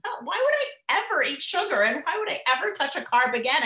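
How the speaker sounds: high, bright, self-satisfied voice, pitched well up and a bit fast; high and pleased with herself, sneering at sugar; smug inner voice. Retold or imitated. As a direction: play the smug morning self who has it all together. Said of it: "And you're like".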